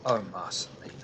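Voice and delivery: breathy whisper to the player. Acted. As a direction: whisper the name. GM addresses player character softly.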